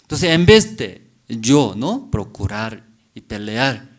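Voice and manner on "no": normal talking voice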